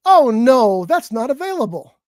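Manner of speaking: mock automated voice with a loud "Oh, no"; exaggerated, drawn-out apologetic bot; AI order-taker voice. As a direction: imitate a drive-through bot turning down an order. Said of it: "And the voice says".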